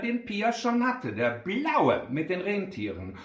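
usual speaking voice

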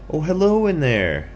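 high, loud calling hello; friendly skunk. Acted. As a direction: call into the pen as a little skunk. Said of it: "the skunk said".

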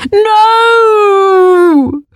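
high, loud cry of no; a boy's sharp shout of alarm; cried out. A narrator's reading. Arthur cries out to his friend in the lava, shouting. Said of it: "cried Arthur".

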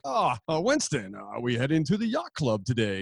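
put-on languid East Coast yacht-club accent, sinking low; upper-crust Massachusetts accent. Yacht-club type addressing Winston, mock Massachusetts accent. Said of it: "in Massachusetts, they're like".

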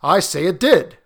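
loud, firm contradiction; insistent retort. A narrator's reading. Husband contradicting his wife, loud and firm. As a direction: push back hard on her doubt.

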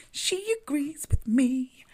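high, loud, drawn-out delight; excited, singsong vindication; gleeful triumph. Real, not acted. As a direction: crow it slowly in a high voice. Triumphant at card agreeing with her.

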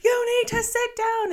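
very high, loud panicked yell; shrill, loud command at a running toddler; frantic shout. Retold or imitated. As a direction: scream it over the choppy water. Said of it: "he kept shouting".

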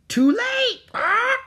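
high loud squawking parrot. Acted. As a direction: screech it like a bird. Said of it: "came a squawk".

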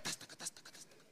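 quick voiceless hoof clatter; whispered gallop clip-clop. Retold or imitated. Preacher miming the horse galloping away. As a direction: patter out horse hooves.

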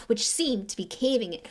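plain reading voice

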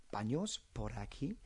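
gentle question rise landing on "aquí"; rising question tune. Shown as a demonstration. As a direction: lift "aquí" at the end like a question. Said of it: "you would go up at the end".

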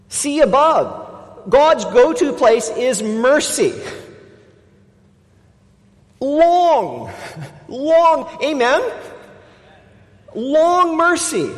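high, ringing, slow preacher's cry; fervent amen-calling preacher; preaching at full force. Acted. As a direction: lift the room, stretch every word. Preaching climax on mercy.

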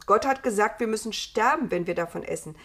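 loud, emphatic argument; exclaimed protest. A narrator's reading. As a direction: argue back forcefully, raising the volume. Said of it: "rief Adam". (laut protestierend)

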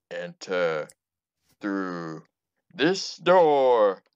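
slow, booming haunted host; spooky ride narrator. Acted. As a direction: drag it out like a spook house host.

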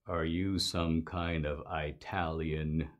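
low, soft, slow hostile voice; a suspicious Klansman at the door, menacing and quiet; man behind the door sizing up a stranger, low and slow; mock Klan leader. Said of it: "imaginative rendition".

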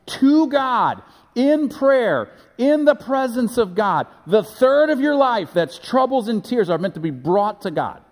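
high and loud, pulpit fervor; preaching at full force. Acted. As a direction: drive it home to the congregation.

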